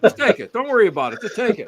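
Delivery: high, loud comic surrender; mock pleading. Handing his wallet to the scary friend, mock pleading.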